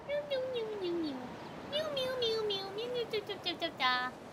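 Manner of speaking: tiny soft run of mews; imitated kitten mews. Streamer mews to Pumpkin, very soft kitten imitation. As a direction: mew very softly like a kitten. Mewing along to the cat.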